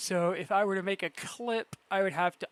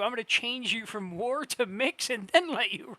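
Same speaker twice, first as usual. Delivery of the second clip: ordinary talk, nothing marked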